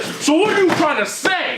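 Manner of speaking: high-pitched mock outrage, laughing; mock mad. Retelling an interviewer getting mad at a rapper, mock mad. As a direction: imitate an angry challenge, voice shooting up. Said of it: "He's getting mad".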